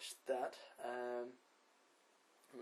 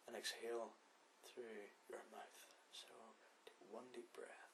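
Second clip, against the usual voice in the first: guided meditation; 14 dB quieter than usual. Acted. Podcast host guiding listeners through breathing, soft meditation voice. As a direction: lead the breathing gently with long pauses.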